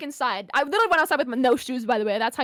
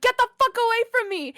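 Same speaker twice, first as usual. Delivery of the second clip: high-pitched mock yell; re-enacted outburst, pitched way up; retold angry warning; 10 semitones higher than usual. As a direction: act out snapping at a pursuer. Retold warning to supposed pursuer.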